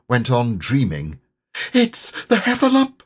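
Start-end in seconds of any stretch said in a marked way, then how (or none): 1.7-3.0 high, squeaky nervous gasp of a thought; a small animal frightened, much higher; nervous squeak; nervous Piglet. A narrator's reading. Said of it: "thought Piglet nervously".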